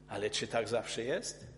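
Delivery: usual speaking voice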